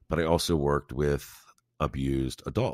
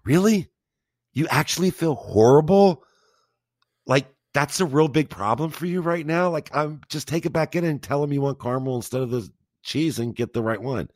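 much higher, louder, mock-disbelieving really; incredulous sarcastic retort; 9 semitones higher, 4 dB louder than usual. To a friend who bought the wrong popcorn, incredulous sarcastic retort. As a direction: scoff at their guilt, pitched way up. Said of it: "And I looked at them and I said".

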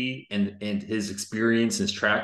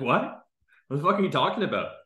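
incredulous outburst; 6 semitones higher than usual. Retold or imitated. Coach's retorted disbelief.

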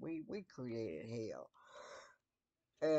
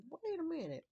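high startled protest; wait, what just happened; a sudden high-pitched double take. Retold or imitated.

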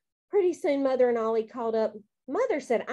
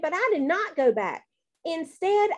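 ordinary delivery, nothing marked

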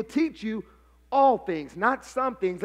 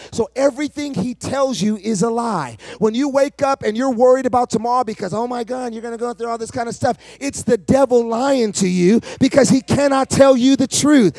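loud, driving sermon shout; full-force preaching; 10 dB louder than usual. Real, not acted. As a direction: preach it at the top of your voice, relentlessly.

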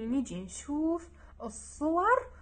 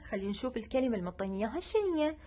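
ordinary talk, nothing marked

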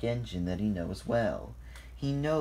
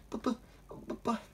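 halting, repeated false starts; stuttering; 13 semitones higher, 5 dB quieter, faster than usual. A narrator's reading. Thomas meets Lady unexpectedly, stuttering. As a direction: stammer it in short, broken bursts. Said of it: "Thomas stuttered".

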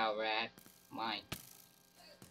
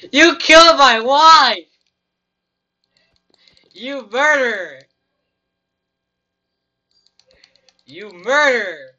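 mock outraged scream; 9 semitones higher, 14 dB louder than usual. Gamer accusing Rad of killing his wife, hammed-up furious scream. Wife was killed in game.

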